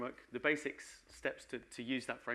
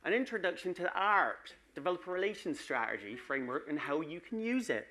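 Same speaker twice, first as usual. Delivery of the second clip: Wessex accent; 6 semitones higher than usual. Shown as a demonstration. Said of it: "say it in the County of Wessex accent".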